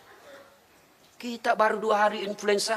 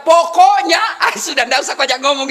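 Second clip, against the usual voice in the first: a loud, high nagging-wife blurt; mock shout; 6 semitones higher, 7 dB louder than usual. Preacher mocks a wife who won't listen, loud blurt. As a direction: belt it out like a stubborn wife cutting off debate.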